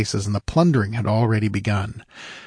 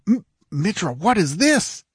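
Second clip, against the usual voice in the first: startled; 9 semitones higher than usual. A narrator's reading. Squire suddenly sees disaster coming.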